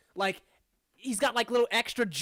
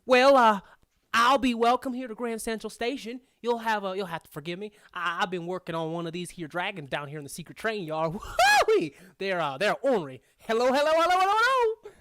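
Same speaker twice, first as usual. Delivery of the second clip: put-on crazy old prospector; 5 dB louder, faster than usual. Gnome welcoming the party to the station, old prospector. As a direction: ramble folksily, bursting into loud whoops. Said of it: "crazy old prospectors".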